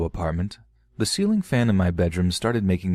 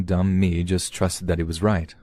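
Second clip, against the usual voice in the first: usual speaking voice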